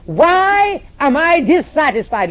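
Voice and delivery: quoted preacher's question. Retold or imitated. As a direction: mimic the troubled pastor's plea. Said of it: "the preacher said in front of all of his staff".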